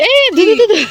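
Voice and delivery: high sing-song call to a pet; cooing to goat. Real, not acted. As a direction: coax the little goat in a high voice.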